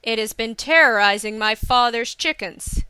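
loud, indignant accusation; angry demand. A narrator's reading. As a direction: accuse forcefully, like an angry lord. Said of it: "he demanded".

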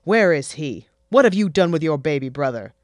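loud, fast demanding scold; furious at her son; angry mother. Acted. Mother demanding where the brother is, angrily.

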